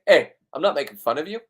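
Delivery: normal talking voice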